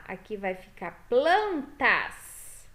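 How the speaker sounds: "plantas" said slowly with its final S brought out; slow stressed plural. Shown as a demonstration. Teacher shows kids the plural "plantas", slow and stressed. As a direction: draw out "plantas", landing on the S. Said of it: "pondo o S no final".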